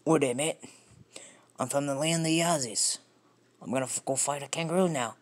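amateur Australian accent attempt. Shown as a demonstration. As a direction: play a cartoonish Australian in a put-on accent. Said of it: "I'll try that one again".

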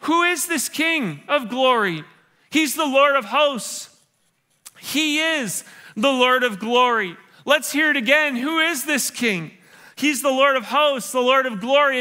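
slow, emphatic preacher's cadence; fervent preacher proclaiming the King of Glory; slow preaching. Acted. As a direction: drive each phrase home. Preaching at full force.

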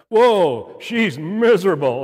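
loud, high retold "whoa" outburst; mock exclamation. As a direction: call it out loudly, imitating the wife's verdict. Wife calls out in retold joke.

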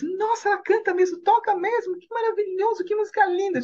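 very high, gushing praise; smitten admiration. Retold or imitated. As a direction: imitate amazed fans raving. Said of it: "Já ficaram apaixonados".

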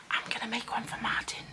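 secret unvoiced whisper. Real, not acted. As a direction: whisper so he can't overhear. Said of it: "I have to whisper so he doesn't hear me".

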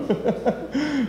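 high giggle at oneself; real giggle. Real, not acted. Presenter admitting the title slip to audience, high giggle. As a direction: giggle over your own slip. Laughs at own title mistake.

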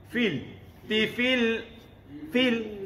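Fil with a clear ee, lips spread. Shown as a demonstration. Teacher corrects students, Fil as "feel". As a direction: grin a little on the i of Fil. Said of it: "Renyih sikit".

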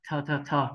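"thờ" repeated with a breathy aspirated th; teacher drilling the aspirated "thờ"; aspirated th sound. Shown as a demonstration. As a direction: puff of air on each "thờ". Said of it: "cứ đọc cả âm ờ cũng được ạ".

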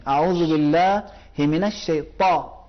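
slow "الشیطان" with a thick, firm ط; correct heavy ta. Shown as a demonstration. Teacher modelling the correct heavy ta. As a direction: recite it slowly, the ط pressed hard and heavy. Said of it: "نہیں".

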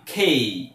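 খেই with a strong puff after the K, falling low; long, low-falling খেই with aspirated K; correct English K. Shown as a demonstration. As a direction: aspirate খেই and draw out its glide. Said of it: "মানে কেইও না".

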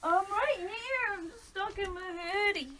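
muffled by clothing. Real, not acted. Friend stuck in a hoodie answering, muffled and small. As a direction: speak from inside a hoodie.

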